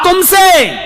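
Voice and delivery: high, loud answer; high, loud reply to the puzzled man; sharp retort. Acted. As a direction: point straight at him. Umar retorts.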